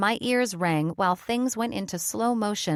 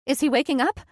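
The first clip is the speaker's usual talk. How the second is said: higher and louder, fearful plea; frightened question; 6 semitones higher, 5 dB louder, faster than usual. Acted. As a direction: ask in fear, pitched up. Frightened mom asks about son.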